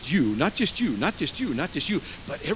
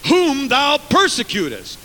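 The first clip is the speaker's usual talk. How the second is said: "Whom thou persecutest" slow, high and heavily stressed; double emphasis; 10 semitones higher, 7 dB louder, slower than usual. Shown as a demonstration. Preacher showing Jesus emphasized it again, heavy stress on "Whom thou persecutest". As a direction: punch "Whom" and weigh each word. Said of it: "double emphasis".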